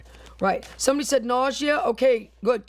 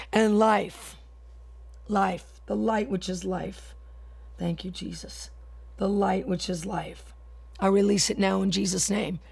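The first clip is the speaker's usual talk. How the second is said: softer, lower murmured prayer; hushed prayer; 5 semitones lower, 5 dB quieter than usual. Acted. Preacher quietly releasing light after deliverance, hushed prayer. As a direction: let the prayer sink to a soft hush.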